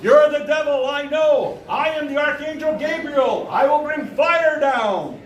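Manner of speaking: high-pitched fire-and-brimstone proclamation; self-proclaimed archangel. Retold or imitated. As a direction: rant like a deranged prophet. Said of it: "the guy goes".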